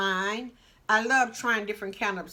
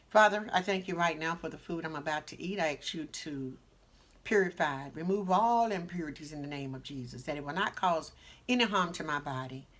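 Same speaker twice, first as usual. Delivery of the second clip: prayer; 5 semitones lower than usual. Real, not acted. Blessing the food.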